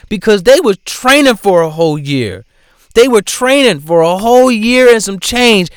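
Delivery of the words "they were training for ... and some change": emphatic. Real, not acted. Ranting about rivals training.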